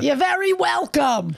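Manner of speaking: high, put-on Long Island voice; Long Island accent. Podcast partner accepting his thanks, broad mock Long Island accent. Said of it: "a voice that I use to be Long Island".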